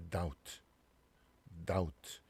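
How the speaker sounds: "doubt" as dowt, no B; "doubt" with the B dropped; silent B. Shown as a demonstration. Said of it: "B가 발음이 다 안 되죠".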